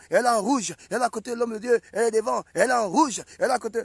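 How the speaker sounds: chanted, repetitive mock prophecy; mock prophesying. Jealous church sister prophesying against pastor's wife, chanted mock prophecy. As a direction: imitate a prophetess intoning short, insistent refrains. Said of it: "elle a commencé à prophétiser".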